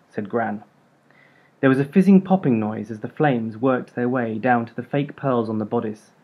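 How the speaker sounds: ordinary delivery, nothing marked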